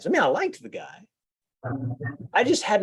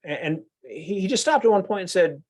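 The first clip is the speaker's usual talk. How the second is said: ordinary delivery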